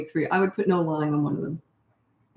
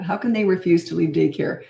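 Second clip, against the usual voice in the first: normal talking voice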